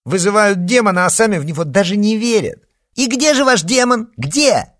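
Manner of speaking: put-on high, loud, outraged girl; indignant rant. A narrator's reading. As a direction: rant at the goths, offended. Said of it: "Алёна возмущённо вскочила с дивана". (возмущённая тирада, громко и высоко)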